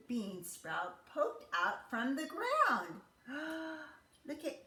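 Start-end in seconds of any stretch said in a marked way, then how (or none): 3.3-3.8 soft sharp intake of breath; surprised gasp. Acted. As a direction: gasp in wonder. Sprout appears, storyteller gasps.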